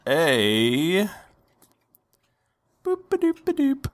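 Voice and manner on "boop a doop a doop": quick high boop-a-doop; silly high scat. Pretend. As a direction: sing it like a cartoon. Silly sound while rolling.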